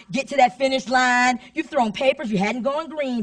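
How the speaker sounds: ordinary delivery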